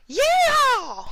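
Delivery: very high shriek; piercing battle shriek; long high yell. A narrator's reading. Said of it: "came a familiar shriek".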